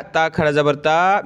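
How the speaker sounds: ordinary talk, nothing marked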